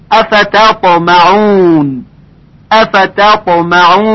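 افتطمعون slowly with a thin ت; correct recitation. Shown as a demonstration. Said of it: "غلط هست".

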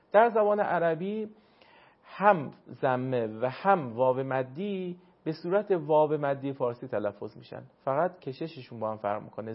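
ordinary delivery

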